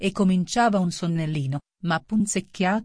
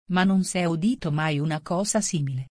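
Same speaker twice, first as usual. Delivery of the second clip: ordinary delivery, nothing marked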